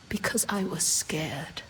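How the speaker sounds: whispered confession; barely voiced apology; ashamed whisper.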